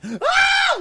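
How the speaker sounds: put-on high, loud childish shriek of fright; retold scream. Child seeing a daddy longlegs, high frightened shriek. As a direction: shriek like a scared kid at a spider. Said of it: "I'd be like".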